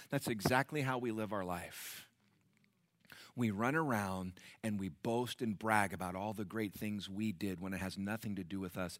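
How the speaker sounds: ordinary delivery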